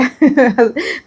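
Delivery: helpless laugh that rises in pitch; giggling fit; real laugh. Real, not acted. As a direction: laugh until tears come. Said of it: "हंसी से देखो आंसू भी आ गए मेरे".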